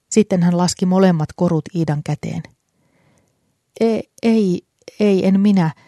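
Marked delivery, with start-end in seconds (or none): 3.8-5.7 flustered stutter, breaking off on the first word; stammering. A narrator's reading. Iida stammers, refusing the old man's gift, flustered stutter. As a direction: trip over the words, caught off guard. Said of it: "Iida änkytti".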